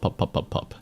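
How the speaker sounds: soft breathy pop sounds; unvoiced popping noises. Shown as a demonstration. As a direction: puff out little bubble pops. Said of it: "and the pop".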